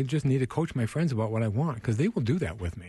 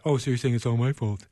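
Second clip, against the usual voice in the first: mock defensive comeback; lightly hammed-up defensive retort; 3 dB louder than usual. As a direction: take offense, acting out a touchy friend. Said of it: "people get all defensive".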